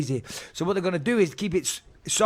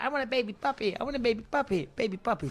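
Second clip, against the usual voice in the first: higher, softer mock child nagging, fading down; whiny kid; 4 semitones higher, 6 dB quieter, faster than usual. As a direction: whine like a kid begging all day. Son nagging for a puppy.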